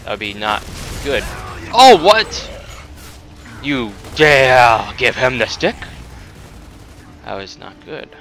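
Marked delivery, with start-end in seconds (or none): 1.7-5.8 loud, slow "you dare" in fake fury; hammed-up indignant shout; mock outrage.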